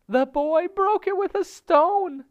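very high, loud, drawn-out bird shriek. A narrator's reading. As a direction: shriek it slowly in bird's falsetto. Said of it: "shrieked Darzee's wife".

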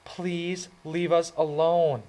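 pleading. A narrator's reading. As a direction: ask him to go, pleading slowly. She said.

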